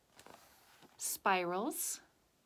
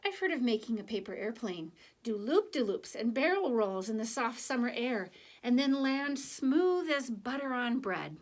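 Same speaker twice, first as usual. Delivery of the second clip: put-on lively, higher read-aloud voice for children; story narration; 3 semitones higher, 3 dB louder than usual. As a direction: tell it to the kids with a sense of wonder. Reads picture book to class.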